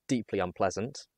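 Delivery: ordinary delivery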